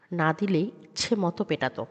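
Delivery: usual speaking voice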